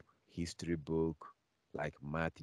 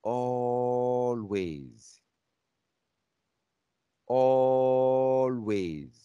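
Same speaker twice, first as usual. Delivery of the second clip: ALWAYS stretched slow, high and loud; drawn-out preacher's shout of always; booming emphasis; 6 semitones higher, 10 dB louder, much slower than usual. Real, not acted. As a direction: hammer the word always home.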